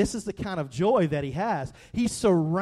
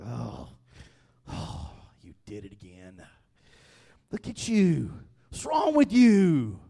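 disappointed scolding; 6 semitones lower, 4 dB louder than usual. Acted. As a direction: sigh it like a fed-up parent. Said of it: "picture that God's like".

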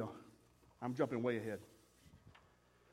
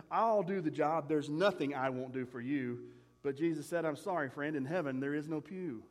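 poem recitation; 6 semitones lower than usual. Acted. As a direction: recite the verses gently to the congregation. Poem continues.

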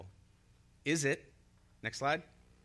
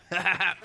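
a loud, high laugh of relief; relieved loud laugh when the slide comes up; relieved burst of laughter; 6 semitones higher, 9 dB louder than usual. Real, not acted. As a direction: laugh out as the slide finally appears. Slide finally found, relieved.

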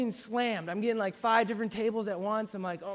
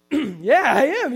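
startled yes; 5 semitones higher, 13 dB louder, slower than usual. Retold or imitated. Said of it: "And I was like".

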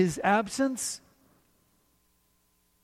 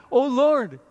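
calling out loud in alarm; servant's panicked loud plea; frightened cry; 6 semitones higher, 6 dB louder than usual. Acted. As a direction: cry out to the Lord in fear. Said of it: "the servant cries out".

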